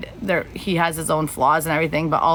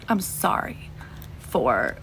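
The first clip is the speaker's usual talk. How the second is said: breathless whispered sorry; whispered apology; 5 semitones lower, 4 dB quieter, much slower than usual. Real, not acted. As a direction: let "I'm sorry" fall to a whisper. Apologizing to Misty, emotional.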